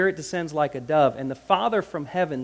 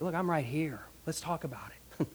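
soft, dropping to a whisper; gently reassuring; 11 dB quieter than usual. Acted. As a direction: lean in and quietly reassure. Voices Jesus reassuring the striver.

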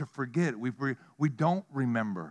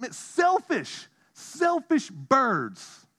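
mock outrage; 10 semitones higher, much slower than usual.

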